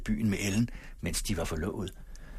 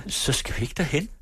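higher, startled rising question; dismayed question; 7 semitones higher, 4 dB louder than usual. A narrator's reading. Ellen asking Sigvald in dismay, higher pitch. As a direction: ask in sudden dismay.

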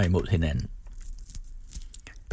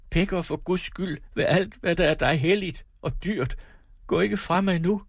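much higher, begging in panic; desperate pleading; 9 semitones higher than usual. A narrator's reading. Wife begging her husband not to go, desperate. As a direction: plead as if he is leaving forever.

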